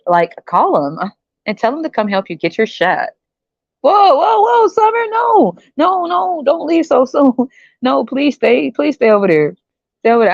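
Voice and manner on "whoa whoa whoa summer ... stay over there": hammed-up cry of stop, stay; mock shout. Shouting Summer not to leave.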